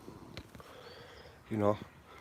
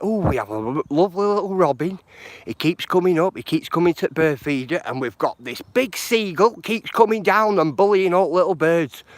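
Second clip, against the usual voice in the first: excited caller; 7 semitones higher, 4 dB louder than usual. Retold or imitated. Excited caller describing her garden robin.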